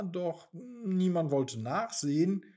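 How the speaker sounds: ordinary delivery, nothing marked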